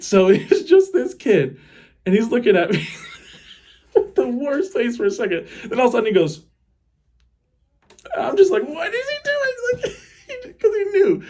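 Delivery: high, squeaky words through real laughter; laughing helplessly. Real, not acted. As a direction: crack up while telling it. Cracking up at own fart story.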